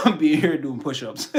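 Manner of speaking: talking through laughter; laughing. Real, not acted. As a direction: laugh the words out. Laughing while exercising.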